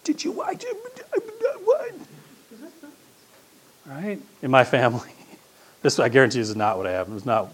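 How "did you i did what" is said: high, stammering disbelief; confused amazement after the mountain; flustered disciple. Retold or imitated. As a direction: babble like a dazed witness. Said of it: "talking among the three of you like".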